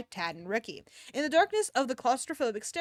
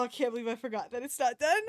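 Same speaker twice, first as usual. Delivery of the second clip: usual speaking voice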